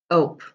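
"ope" said with no R in front; just the "ope" part, no R; beginning sound dropped. Shown as a demonstration. Teacher to pupils: "ope" without its first sound. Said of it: "drop the beginning sound".

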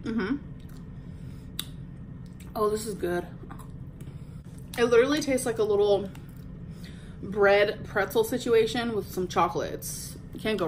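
talking while chewing snack; speaking between bites; mouth full. Real, not acted. Seen 1: Bites the snack stick at 0.3–0.8, then chews while talking.

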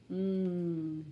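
N as a long low "nnn"; letter sound. Shown as a demonstration. Teacher drilling letter sounds, N as "nnn". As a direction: hum N with no vowel.

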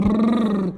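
loud shivery brrr; shivering brrr. Shown as a demonstration. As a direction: lips trilling from the cold. Acting out cold for child.

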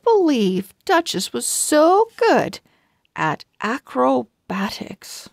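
bright storybook reading with lively pauses; reading to children. A narrator's reading. Host reading a chapter to young viewers, storybook narration. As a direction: read it like a picture book for little listeners.

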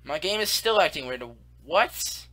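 normal talking voice

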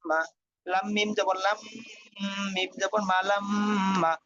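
usual speaking voice

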